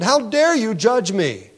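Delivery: slow, indignant mock challenge; indignant retort. Preacher voicing an offended neighbor, indignant and slow. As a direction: throw the neighbor's accusation back, slowly.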